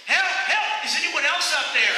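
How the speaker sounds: high, loud cry for help; yelling for help. Acted. Jack refuses God's advice and yells, loud cry for help. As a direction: scream up the cliff for anyone else. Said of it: "and Jack yells".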